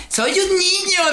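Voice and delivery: put-on very high, drawn-out kid voice; little boy. Young player answering older gamers online, mock little-boy voice. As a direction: imitate a small boy insisting, laughter in it. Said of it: "una vez con un niño".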